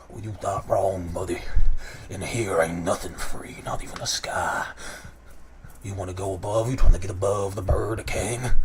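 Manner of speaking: deep chest voice of a taunting crow gang leader; deep-voiced Kenku boss. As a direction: sneer at the newcomer from deep in the chest, like a tough bird boss. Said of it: "looks toward you and says".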